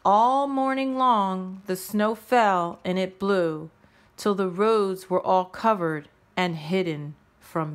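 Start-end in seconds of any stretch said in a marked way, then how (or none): none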